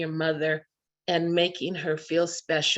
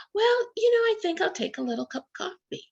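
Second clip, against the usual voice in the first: much higher, quick, coy little request; coy old mother; faster than usual. Retold or imitated. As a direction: act innocent while asking for the forbidden treat. Retelling Mommy's coy coffee request.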